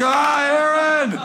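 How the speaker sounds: high, frantic cry for help; hammed-up shriek of disgust; mock panicked yell. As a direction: yelp for Aaron in horror. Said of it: "I'm like".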